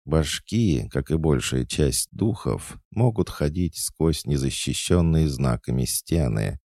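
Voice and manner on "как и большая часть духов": plain reading voice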